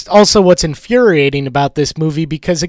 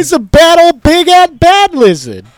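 high, loud cartoon critter voice; silly voice; 11 semitones higher, 6 dB louder than usual. Co-host riffs on the lizard, in a high, loud cartoon voice. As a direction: squeak it out gleefully. Goofy character voice.

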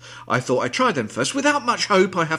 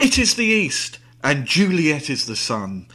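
mock Romeo reciting to open the show; hammed-up Shakespeare; 3 dB louder than usual. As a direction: declaim it like a ham Romeo.

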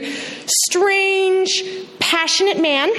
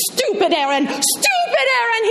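mock yell; 7 semitones higher than usual. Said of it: "he cried as he jumped".

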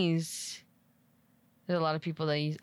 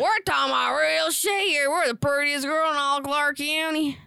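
loud, high mock southern accent; hammed-up southern accent; 7 semitones higher, 6 dB louder than usual. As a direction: ham up a southern accent for a laugh. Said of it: "That's fucking southern".

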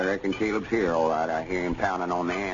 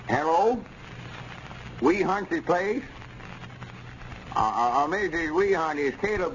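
calling into phone; 5 semitones higher than usual. Acted. Shouting into old telephone.

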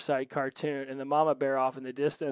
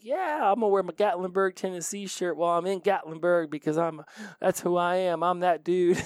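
higher and a bit louder, a hammed-up proud tourist dude; 5 semitones higher, 4 dB louder than usual. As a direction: puff yourself up, mock-bragging. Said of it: "I was like".

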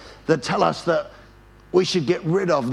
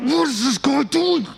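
high, exasperated outburst; mounting rage; 8 semitones higher than usual. Retold or imitated. Driver fuming at the van blocking him, acted rage. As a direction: re-enact road rage, pitched way up. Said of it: "rage is starting to come out".

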